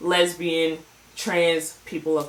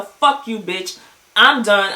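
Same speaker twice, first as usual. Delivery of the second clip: mock angry outburst; 2 semitones higher, 6 dB louder, slower than usual. [name]'s retold blowup.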